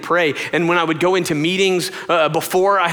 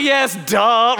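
raised, emphatic duh; mock obviousness; 7 semitones higher, 4 dB louder than usual. Wife answering husband in bed, mock obviousness. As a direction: toss it back as blindingly obvious. Wife's blunt answer acted out.

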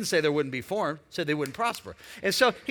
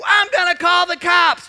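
high, loud yelled threat; the driver shouting back; shouted threat; 10 semitones higher, 11 dB louder than usual. Retold or imitated. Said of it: "He said".

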